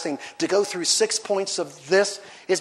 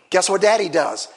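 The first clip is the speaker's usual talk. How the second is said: usual speaking voice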